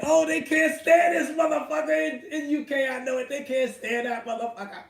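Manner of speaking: high, loud gleeful yell; mock-outraged laughing yell; laughing shout. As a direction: crow it out with glee. Gleeful rant about Brits hating Ruiz.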